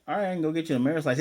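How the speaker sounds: fast, loud deadpan quip; a fast, loud offhand joke; rapid deadpan offer. Retold or imitated. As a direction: rattle it off as a quick joke. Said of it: "And Lloyd's like".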